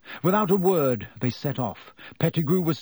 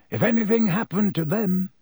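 higher-pitched, stiffly careful aside; 6 semitones higher, 3 dB louder than usual. A narrator's reading. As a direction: leave it hanging, a little stiffly.

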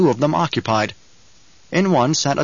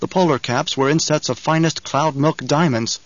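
ordinary delivery

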